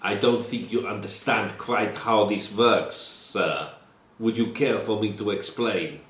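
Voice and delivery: put-on lightly accented Russian revolutionary, scornful; Russian-accented prisoner. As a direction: sneer at the king, Russian accent. Prisoner mocks the king.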